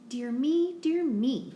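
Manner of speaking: a vet clucking in alarm; a storybook vet's fussy fret; dismayed exclaim. A narrator's reading. Vet reacts to spotty pets.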